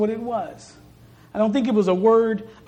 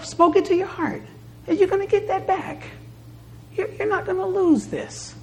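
fervent preaching; 8 semitones higher than usual. Acted. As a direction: lift the congregation with rising fervour. Preaching at full force.

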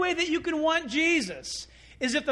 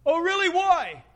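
loud, high-pitched retort; mock challenge; 6 semitones higher, 6 dB louder than usual. Grumbling crowd answering Jesus back, mock indignant. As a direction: throw it back as an acted, indignant question.

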